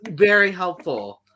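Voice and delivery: dry, slow sarcasm; sarcastic. Real, not acted. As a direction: say it deadpan and sarcastic. Echoing sarcastic thanks.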